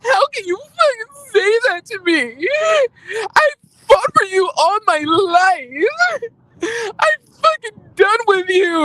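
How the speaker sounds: high, slow, mock-crying wail; imitating sobbing. Jacqueline breaking down at Mia on the show, imitated sobbing wail. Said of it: "she just breaks down into tears".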